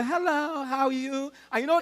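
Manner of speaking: usual speaking voice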